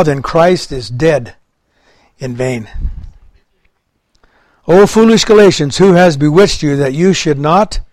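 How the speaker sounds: impassioned preaching of Galatians; full force preaching. Acted. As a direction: proclaim it like Paul rebuking. Preacher reading Paul aloud.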